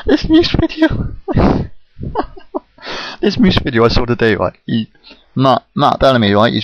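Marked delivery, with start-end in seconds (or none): 0.1-1.1 squeaking the words out through real laughter; can't get it out for giggling; laughing. Real, not acted. First try breaks into laughter.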